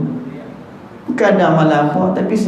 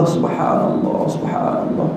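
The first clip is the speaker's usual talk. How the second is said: drowsy whisper; 4 dB quieter than usual. Pretend. Mocking drowsy wirid.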